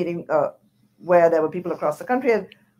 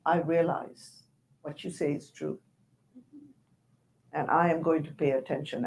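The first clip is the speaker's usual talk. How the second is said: much softer, lower, humbled reply; hushed apologetic chair; 3 semitones lower, 10 dB quieter than usual. Retold or imitated. Retelling the chair's reply to her complaint, hushed and contrite. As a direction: lightly voice the chair's quiet, sorry answer. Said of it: "And he said".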